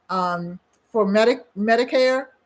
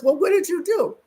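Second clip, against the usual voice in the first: put-on older woman asking; 9 semitones higher, 3 dB louder than usual. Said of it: "the woman says".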